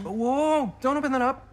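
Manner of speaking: high, loud alarmed "whoa"; urgent stop. Acted. Boy stopping a friend from opening the camera, alarmed. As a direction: rush to stop them opening it.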